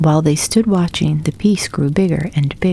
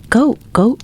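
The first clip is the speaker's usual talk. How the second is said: higher calling cry up the hill; 7 semitones higher than usual. A narrator's reading. Hen calls up the hill to Goat, higher urgent cry. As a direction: call out to him urgently. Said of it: "cried Hen".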